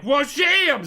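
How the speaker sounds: loud, high attack yell; battle cry. Retold or imitated. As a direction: yell it as you strike. Acted sword attack battle cry.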